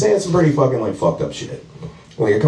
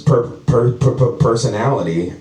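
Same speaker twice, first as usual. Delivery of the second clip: stuck on "per-", repeating it before "personality"; stuttering glitch; 2 semitones lower than usual. Real, not acted. Comic whose brain just reset mid-sentence, real stuttering glitch. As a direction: stammer "per-" like a skipping machine, then get "personality" out. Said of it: "my fucking hard drive just reset".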